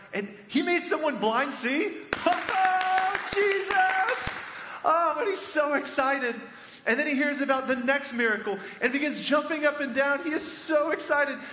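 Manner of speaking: high-pitched, overexcited storytelling; exuberant, loud retelling; way too loud. Real, not acted. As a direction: get carried away with excitement, pitch way up. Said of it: "I'm being way too loud".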